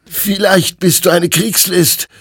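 high, loud challenge; agitated high-pitched soldier; embattled soldier questioning. A narrator's reading. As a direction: question the enemy, pitched far up and forceful. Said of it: "fragte Hector, des Kampfes längst müde geworden".

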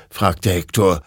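plain reading voice

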